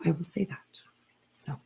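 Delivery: quick whispered aside to herself. Real, not acted. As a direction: drop to a whisper, half to herself.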